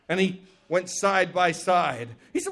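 ordinary delivery

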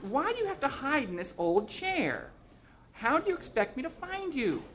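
high, scolding woman's voice; nagging wife. A narrator's reading. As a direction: demand an explanation from a hopeless husband.

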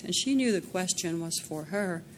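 plain reading voice

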